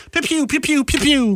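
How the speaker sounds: high laser zap noises; pew pew zaps. Pretend. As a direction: zap like a toy blaster. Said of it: "Those are your favorite".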